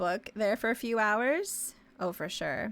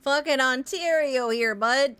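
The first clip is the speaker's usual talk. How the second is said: louder, higher mock Ontario bro voice; small-town Ontario accent; 4 semitones higher, 7 dB louder than usual. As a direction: play up a rural Ontario twang, hammed-up. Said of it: "Yeah, something like that".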